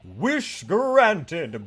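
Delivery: ordinary talk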